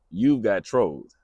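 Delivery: slow, punched catchphrase delivery; catchphrase try-out. Pretend. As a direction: stamp it out like a slogan. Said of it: "Should we try it?".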